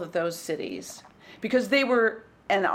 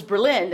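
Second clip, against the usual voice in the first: Berlin said as ber-LIN; stress on second syllable; 4 dB louder than usual. Shown as a demonstration. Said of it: "put the accent on the first syllable".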